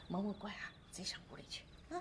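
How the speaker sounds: very soft, low, near-whispered coaxing; hushed coaxing. Acted. Sending little child off to the room, hushed and coaxing. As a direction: gently shoo a child along under your breath.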